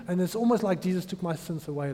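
usual speaking voice

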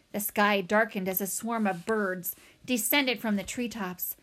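usual speaking voice

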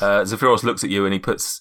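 usual speaking voice

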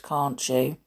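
ordinary delivery

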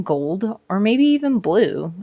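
ordinary talk, nothing marked

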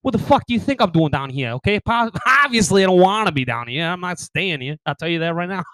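loud, indignant put-on Boston accent. Visitor scaring off Texans, brash Boston accent. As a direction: snap back as a mouthy Bostonian. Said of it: "rather get the Boston accent".

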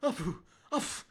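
words cut off by loud fake sneezes; high, hammed-up allergy attack; mock sneezing fit. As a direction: try to talk through repeated sneezes. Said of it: "breaking out in sneezing fits".

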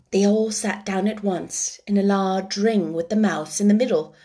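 ordinary delivery

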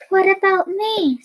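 mildly hammed-up sadness; sad. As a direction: ask it like a left-out little heroine. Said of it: "said Dorothy sadly".

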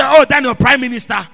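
loud pleading cry; anguished cry. Acted. King crying to Daniel by his title, loud and anguished. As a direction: call out loud with grief. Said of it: "he cried in a voice of anguish".

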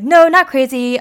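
imitated flustered correction; hasty backtracking. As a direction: rush to take it back. Retold Kristoff hastily backtracking.

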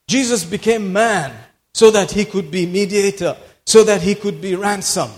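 ordinary delivery

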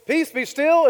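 loud, high command to the storm. Acted. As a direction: call it out with authority over the waves. Voicing Jesus commanding the sea.

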